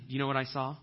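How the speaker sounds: normal talking voice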